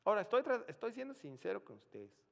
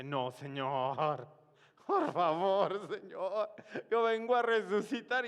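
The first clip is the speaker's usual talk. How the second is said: desperate plea; 5 semitones higher, 10 dB louder than usual. Retold or imitated. Desperate prayer to raise the dead.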